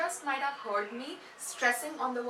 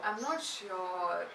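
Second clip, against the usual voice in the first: slow, low-pitched uncertainty; hesitant and unsure; doubtful, low ending; 3 semitones lower, slower than usual. Shown as a demonstration. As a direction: drag the words down low. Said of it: "you end with a low".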